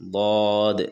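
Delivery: ضاد said thick and heavy, not زواد or دواد; emphatic ض in ضاد; heavy daad. Shown as a demonstration. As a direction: give ضاد a full, heavy sound. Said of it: "موٹی آواز سے ادا کریں گے".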